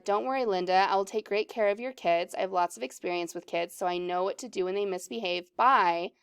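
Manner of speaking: fast, understated read-through; quick recap. Shown as a demonstration. Teacher recaps Corey's lines to find the next part, quickly.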